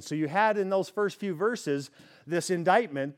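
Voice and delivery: ordinary delivery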